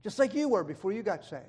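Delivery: ordinary talk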